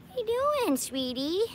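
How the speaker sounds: sweet talk to a frog. Real, not acted. Coaxing a frog off car.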